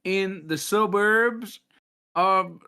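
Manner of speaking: a hint of a slow Southern drawl, slowed down; lightly put-on Southern accent. Guest keeps up his joke Southern accent for the host, slowly. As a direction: play the swamp guitarist in a mock Southern voice. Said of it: "my Alabama accent".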